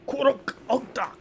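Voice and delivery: high, loud orc command; orc call. Acted. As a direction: summon the pack like an orc. Orc calls its group out.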